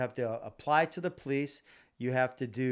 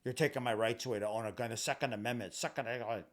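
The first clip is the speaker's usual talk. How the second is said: mocking gun owner; 3 semitones lower, 4 dB quieter, faster than usual. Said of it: "The N R A would say".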